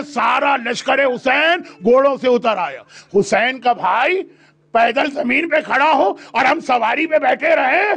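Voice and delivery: usual speaking voice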